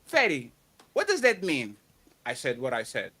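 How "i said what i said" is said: quiet mocking quote. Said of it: "Does that better my argument".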